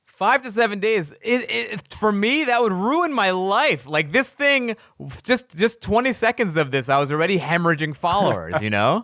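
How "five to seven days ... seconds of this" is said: high-pitched disbelief at the wait; incredulous outrage. Real, not acted. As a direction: voice shoots up in outrage. Outraged at five to seven days.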